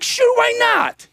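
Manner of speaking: re-enacted high-pitched, drawn-out agreement; eager acceptance. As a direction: jump at the offer, very high voice. Eagerly accepts the offer to teach.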